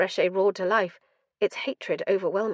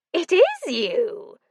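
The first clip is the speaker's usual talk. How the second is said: drawn-out gleeful madman; 10 semitones higher, 5 dB louder, much slower than usual. Acted. Madman triumphant.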